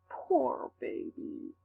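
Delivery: slow, drawn-out evil taunt. Acted. The villain interrupts your prayer with slow evil pity. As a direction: savour each word with cruel pity. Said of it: "that evil voice saying".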